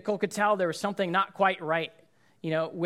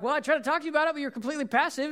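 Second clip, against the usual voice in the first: much higher mock defensive retort; defensive blame; 9 semitones higher, faster than usual. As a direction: throw the blame back, hammed-up. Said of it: "Nicole could have been like".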